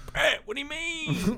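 high, drawn-out mock pleading; slow, squeaky comic protest; mock whining protest. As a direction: stretch it out way up high, playing hurt. Acting out his own future protest.

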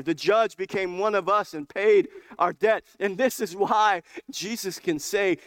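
loud, high, ringing preaching; preaching at full force. Acted. Preacher proclaims the gospel to his church, full-force preaching. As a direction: proclaim it like the climax of the sermon.

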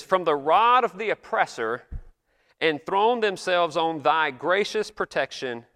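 slow, lifted solemn prayer reading; solemn old prayer. Retold or imitated. Pastor reading an old Congress prayer to the congregation, slow and solemn. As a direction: intone it like an eighteenth-century pastor at prayer. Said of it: "I wish I could go around talking like this today".

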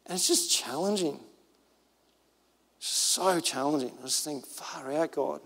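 soft, low musing; hushed, challenged awe; humbled reflection. Real, not acted. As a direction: let it sink in quietly, almost to yourself. Humbled reflection after the story.

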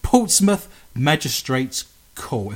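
said slowly, word by word; slow careful pronunciation. Shown as a demonstration. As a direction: spell out each word carefully. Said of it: "That's a difficult thing to say".

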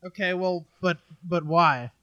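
usual speaking voice

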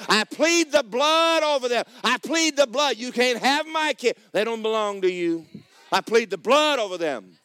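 high, forceful preaching shout; commanding warfare prayer. Acted. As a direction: order the enemy off your children. Acting out driving off the devil.

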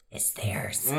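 breathy stage whisper for the reveal; dramatic whisper. Acted. Talk show host whispering the shocking claim to the guest, dramatic whisper. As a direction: whisper it like a juicy secret.

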